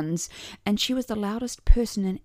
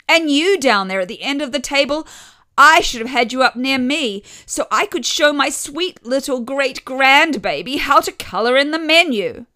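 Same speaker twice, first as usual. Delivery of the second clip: high, loud calling-down-the-table voice; loud grandmother; 6 semitones higher, 8 dB louder than usual. A narrator's reading. Grandmother calling to her granddaughter at the far end of the table, loud and high.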